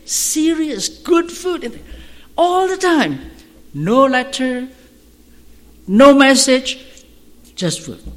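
high, loud and rising with wonder; amazed preaching. Real, not acted. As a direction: marvel at the miracle aloud. Preacher marvels at food gifts.

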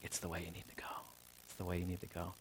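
hushed, near-whispered reply as God; gentle divine correction. Acted. God answering someone who wants back on the tracks, gentle whisper. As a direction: say it low and very softly, repeating it patiently.

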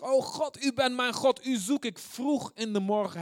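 high, loud, urgent invocation; fervent psalm prayer. Acted. Preacher crying psalm sixty-three to God, fervent.

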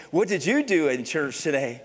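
put-on a parent quizzing their child after church; mock alarmed parent. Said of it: "And your parents are like".

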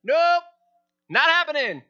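high and loud teasing no; God's playful refusal. Pretend. God to Abram, a high playful brush-off. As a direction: brush off the request with a grin. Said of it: "the Lord said to him".

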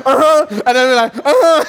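high, loud laughing uh-huh; imitating a churchgoer. Pastor mimicking a congregant's loud affirmations, laughing. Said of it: "he'd be like".

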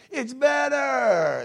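high, long-drawn preacher's holler; exultant shout. Real, not acted. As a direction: stretch it into a loud, high shout. Preacher returns to shouted refrain.

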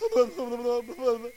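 imitated incoherent blubbering; mock garbled speech noise; garbled babbling. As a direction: babble wordlessly as if trying to talk. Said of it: "all they were hearing was".

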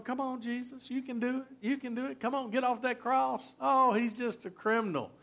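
preacher imitating the mockers egging Jesus on, sarcastic; trash-talking taunt. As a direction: goad him with sarcastic encouragement. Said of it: "they were talking trash to him".

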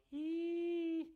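very high, loud sustained "ee"; piercing imitated electronic squeal; hearing aid squeal. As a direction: whine like feedback. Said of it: "But it goes".

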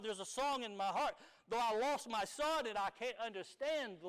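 impassioned pulpit sermon delivery; full-force preaching. Acted. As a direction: preach it out to the congregation.

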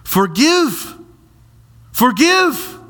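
"Forgive" lifted high and stretched; emphatic Forgive. Shown as a demonstration. Preacher dwelling on the Greek word, high. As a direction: ring out "Forgive" high twice. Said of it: "it's actually one word, aphete".

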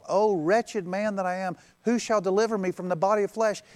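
preacher voicing Paul's anguished cry; Paul's lament. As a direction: cry out his wretchedness in despair. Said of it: "calling himself".

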